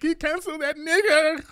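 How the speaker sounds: very high-pitched mimicry; mock outrage. Upset online critics demanding he be canceled, high mock squeal. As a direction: squeal it like a hammed-up angry complainer.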